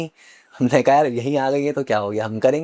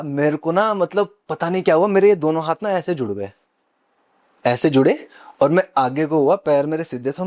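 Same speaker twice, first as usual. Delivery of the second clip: normal talking voice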